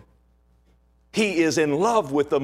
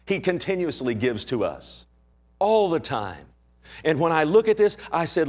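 usual speaking voice

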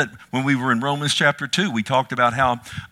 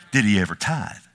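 normal talking voice

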